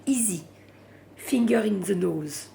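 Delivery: English with a thick French accent; clumsy French-accented English; bad English accent. Real, not acted. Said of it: "mon accent anglais est dégueulasse". (mauvais accent anglais à la française)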